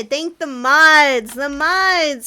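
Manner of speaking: drawn-out cheer for the mods; slow loud shout-out. Real, not acted. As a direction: thank the mods slowly and loudly.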